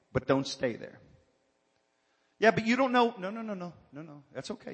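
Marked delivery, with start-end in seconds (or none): 2.4-3.1 complaining believer. Retold or imitated. 3.2-4.7 dropping to a hush, gently soothing; calm them down softly, almost whispered; soft reassurance. Real, not acted. Pastor soothing a complaining church member, hushed and gentle.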